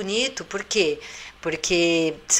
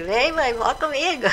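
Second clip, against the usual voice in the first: put-on high, sweet baby coaxing his mum; playful little-baby plea; cooing baby voice; 8 semitones higher than usual. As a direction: coax mummy to come play, high like a baby.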